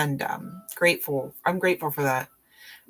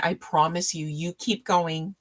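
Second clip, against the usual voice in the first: ordinary delivery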